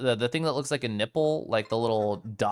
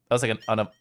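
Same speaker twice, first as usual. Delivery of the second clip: ordinary delivery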